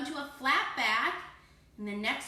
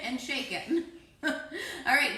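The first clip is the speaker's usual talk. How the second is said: laughing; 3 dB louder, slower than usual. Real, not acted. Child's joke makes her laugh.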